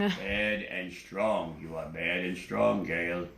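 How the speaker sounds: very low, slow stern master voice. Acted. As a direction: speak as a slow, deep, stern teacher. Master repeats judgment.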